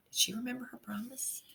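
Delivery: soft unvoiced question to the kids; whispered aside. A narrator's reading. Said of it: "aside to listeners".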